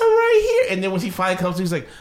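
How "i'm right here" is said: mock tearful child. Little sister to brother ignoring her, wailing in mock distress. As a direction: imitate a little girl starting to cry. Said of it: "She starts crying".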